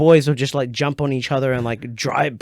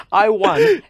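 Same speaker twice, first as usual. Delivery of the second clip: laughing; 7 dB louder, slower than usual. Real, not acted. Won the crowd vote, laughing.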